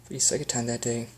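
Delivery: plain reading voice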